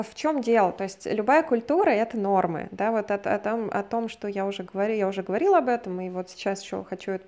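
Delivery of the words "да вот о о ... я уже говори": normal talking voice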